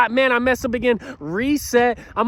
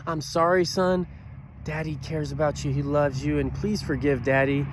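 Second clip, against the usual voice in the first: kneel down and say sorry gently; a father softly apologizing to his little boy; tender apology to son; 7 semitones lower, 5 dB quieter than usual. Retold or imitated.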